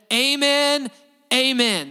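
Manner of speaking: loud, high, ringing amen shouted twice; emphatic amen. Retold or imitated. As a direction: cry it out like a whole crowd agreeing. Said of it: "And they're emphatic about it".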